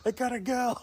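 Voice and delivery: high, loud pleading as an impatient friend; mock urgency. Friend needing the toilet pleads with coworker, mock urgency. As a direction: imitate a desperate friend begging to leave.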